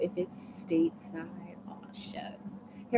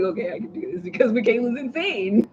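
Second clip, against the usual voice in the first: said through laughter, louder; laughing; 3 semitones higher, 8 dB louder than usual. Pretend. Nervous writer laughing at her cheering, said through laughs. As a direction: laugh through the pep talk. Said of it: "you're gonna be like".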